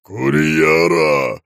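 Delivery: slow, loud, booming shout; terrible shout. A narrator's reading. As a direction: thunder it through the door. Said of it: "грянул страшный голос".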